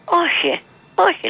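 mother exclaiming no in surprise; emphatic no. Retold or imitated. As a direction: deny it quickly, pitch jumping up.

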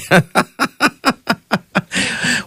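sudden high burst of real laughter; a real laugh that trails off. Real, not acted. Laughs at listener's message.

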